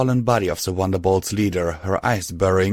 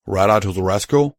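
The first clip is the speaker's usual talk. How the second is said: ordinary delivery, nothing marked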